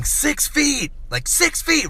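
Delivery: high-pitched imitation of a yelling old lady; mock yelling. Old lady warning a shopper off at the cheese aisle, mock yelling. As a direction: yelp it high, mimicking an alarmed stranger. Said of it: "she starts yelling".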